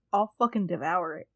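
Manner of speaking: ordinary talk, nothing marked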